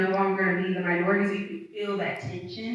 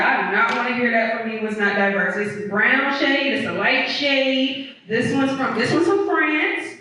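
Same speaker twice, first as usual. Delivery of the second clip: higher, louder, insistent mock retort; exasperated comeback; 5 semitones higher, 4 dB louder than usual. Answering coworkers who call her slides not diverse, exasperated and raised. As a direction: hammer it home that it is diverse, fed up. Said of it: "I was like".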